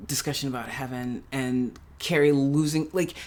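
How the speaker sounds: usual speaking voice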